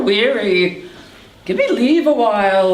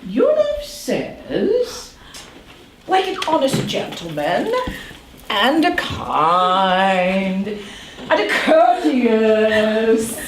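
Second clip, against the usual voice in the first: teasing drawn out; slower than usual. Acted. Nurse teasing slowly.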